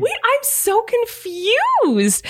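high, very slow confused drawl; bewildered. Real, not acted. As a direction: puzzle it out, stunned. Confused about the word.

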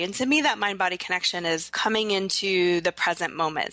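normal talking voice

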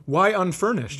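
ordinary talk, nothing marked

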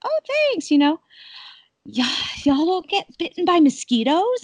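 high, bright hostess voice; high mock-polite hostess; sweet host. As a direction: play the gracious host. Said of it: "I'm like".

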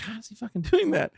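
high-pitched retold wonder; amazed disbelief. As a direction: puzzle aloud, voice lifted high. Dad marvels at son's running.

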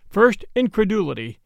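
ordinary delivery

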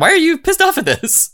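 exasperated wife. Retold or imitated. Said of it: "has to be like".

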